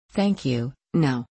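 cool, clipped refusal with no warmth; crisply cold. A narrator's reading. A woman refusing a tired rider's invitation, crisply cold. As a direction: turn down the offer coldly, keeping distance. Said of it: "voice was as crisply cold as the wind".

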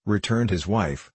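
ordinary delivery, nothing marked

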